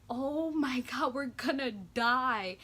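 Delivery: slow, drawn-out, frightened disbelief; slow, stunned fear; retold terror. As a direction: say it like the crash is inevitable. Retelling terror facing the lady.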